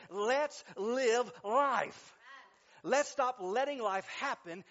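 loud, forceful preaching climax; rallying shout. Real, not acted. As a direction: punch out each word to rouse the congregation.

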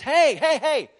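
loud, high retold call; woman hailing the pastor in the parking lot. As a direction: grab his attention from behind. Woman calling after him.

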